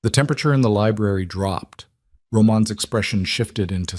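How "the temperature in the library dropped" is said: ordinary delivery, nothing marked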